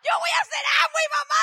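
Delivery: loud, fast, high tearful vow; crying shout. Retold or imitated. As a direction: burst out with the promise through tears.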